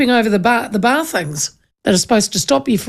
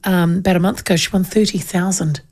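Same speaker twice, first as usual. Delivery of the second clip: ordinary delivery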